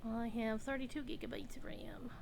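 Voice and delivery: soft unvoiced whisper, almost secretive; hushed aside with a grin. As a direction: whisper the correction to yourself. Whispered correction after a laugh.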